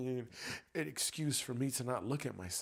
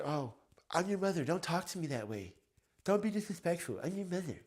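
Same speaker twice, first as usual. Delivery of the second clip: higher, louder mock mother scolding; indignant mother; 5 semitones higher, 5 dB louder than usual. Mother scolding her grown child, mock indignant. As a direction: pull rank as the offended parent. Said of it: "then they would've said".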